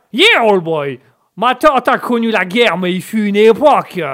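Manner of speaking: put-on loud American old boy; American accent. Coach recalling aunt who knew the war, loud American accent. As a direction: declaim the family tale in an American accent. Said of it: "l'Américain a un petit chat dans la gorge".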